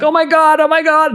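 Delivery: put-on high, wailing kid voice; child in a tantrum. Tantrum-throwing child wailing at dad, high mock wail. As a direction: wail like a toddler mid-tantrum. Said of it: "they're throwing a tantrum".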